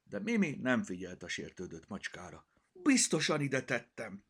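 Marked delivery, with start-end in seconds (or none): 2.7-4.2 louder, despairing insistence; girl near panic; desperate. Acted. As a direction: plead in despair. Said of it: "kétségbe esetten".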